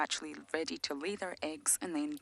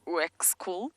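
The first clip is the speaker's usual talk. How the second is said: attempted German accent; much slower than usual. Shown as a demonstration. Guide trying a German scientist's name, attempted German accent. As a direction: have an unsure go at a German accent. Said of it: "that's my German accent".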